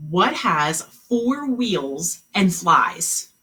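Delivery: slow, drawn-out question; slow riddle reading. Shown as a demonstration. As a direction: read the riddle slowly, word by word. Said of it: "Here was the riddle".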